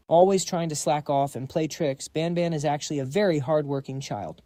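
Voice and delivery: ordinary delivery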